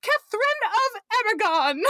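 high-pitched mock yell, little added volume; retold yell. Distraught Niles yelling from the kitchen, mock yell. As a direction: imitate a distraught cry without really shouting. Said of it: "And Niles yells".

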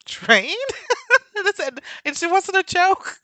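very high, laughing helplessly; cracking up. Real, not acted. Telling of the train mistake, laughing hard. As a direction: squeeze the words out through laughter.

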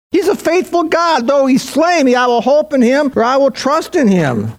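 high, ringing sermon delivery; impassioned preaching. Real, not acted. Preacher to congregation on Job's faith, impassioned preaching. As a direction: preach it with pitch held high.

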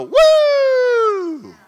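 a high, loud, joyful whoop like splashing water; gleeful whoop. Retold or imitated. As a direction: let out a wild hose-spraying whoop. Spraying with a water hose.

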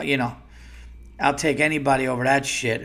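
ordinary delivery

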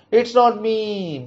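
lightly hissed protest; hissed denial. Acted. Boy hissing back that it is not him. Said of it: "Boltu hisses".

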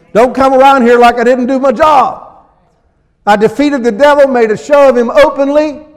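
loud, high, forceful sermon shout; preacher rousing the congregation against the devil, loud; fiery preaching. Acted.

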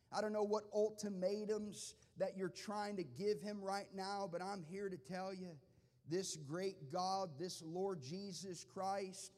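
fervent sermon climax; full-force preaching. Acted. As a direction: drive the point home to the crowd.

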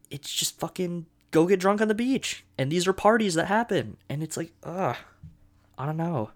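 exasperated. Real, not acted. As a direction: throw up your hands at it. Ranting about the show's premise.

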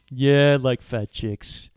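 low, slow mock-defensive admission; defensive, unbothered. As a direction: own up slowly, as if challenged. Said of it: "And he goes".

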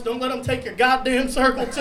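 usual speaking voice

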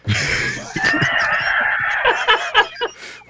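high, breathless burst of laughter; big laugh. Real, not acted. Cracking up at the kids scene, big laugh. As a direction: laugh hard at the joke.

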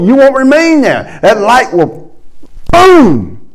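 rising preaching. Acted. Preacher building to a climax for the congregation, loud and rising. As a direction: drive it home to the crowd.

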